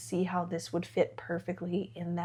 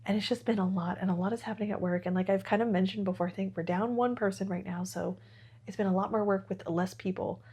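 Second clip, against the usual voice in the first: ordinary talk, nothing marked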